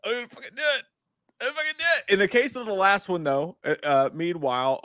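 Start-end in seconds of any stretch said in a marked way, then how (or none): 0.0-2.1 high-pitched mock protest; playful whiny denial. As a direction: squeak it out innocently. Repeated denial, higher each time.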